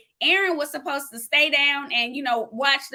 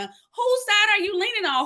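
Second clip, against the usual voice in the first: pitched-up preacher's repeat; higher emphatic repeat; 6 semitones higher than usual. Shown as a demonstration. Preacher repeating a viewer's question to the congregation, pitched higher and pressing.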